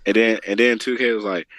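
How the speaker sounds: ordinary delivery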